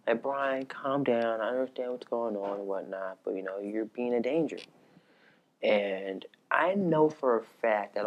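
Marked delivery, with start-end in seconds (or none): 0.2-4.6 soft, hushed reasoning; calm talking-down. Retold or imitated. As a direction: quietly talk someone down. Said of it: "trying to calmly talk to him".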